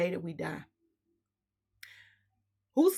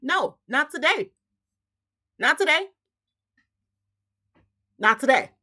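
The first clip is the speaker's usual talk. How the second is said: firm refusal; 5 semitones higher, 7 dB louder than usual. Retold or imitated. Refusing at her property.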